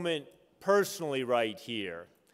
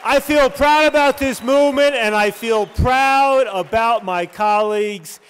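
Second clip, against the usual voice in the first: loud, high rallying shout over the crowd; rousing shout-out; 5 semitones higher, 7 dB louder than usual. Real, not acted. Introducer rallying the room to thank colleagues, rousing shout. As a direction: whip up applause, loud and proud.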